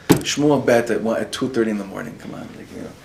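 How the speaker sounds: ordinary talk, nothing marked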